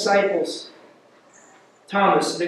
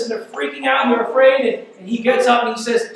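ordinary talk

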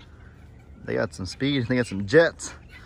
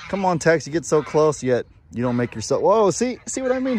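startled; 6 semitones higher, 5 dB louder than usual. Real, not acted. Goat swings horns at hand.

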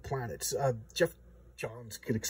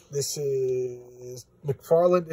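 drawn out very slow, announcing; slow reveal; 5 dB louder, much slower than usual. Real, not acted. Reviewer announcing Todd McFarlane's debut, slow and drawn out. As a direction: unveil the name slowly.

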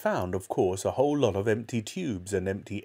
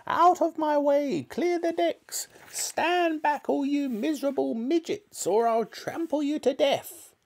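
very high, shrill shriek; hammed-up shouting; 3 dB louder than usual. A narrator's reading. Old hag shouting as she gallops about the farm, high wild yell. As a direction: screech it out loud and high. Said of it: "and shouting".

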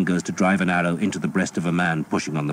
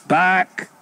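loud, high command; shouted retreat; 13 semitones higher, 5 dB louder than usual. A narrator's reading. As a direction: yell the retreat order. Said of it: "Skeet shouted".

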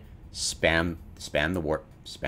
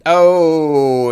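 loud disappointed oh; 10 semitones higher, 15 dB louder than usual. Real, not acted. Item didn't drop.

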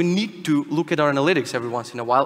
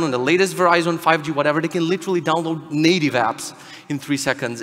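usual speaking voice

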